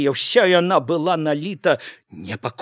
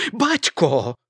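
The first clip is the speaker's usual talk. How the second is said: frightened cry; 5 dB louder than usual. A narrator's reading. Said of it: "вырвалася спалоханая".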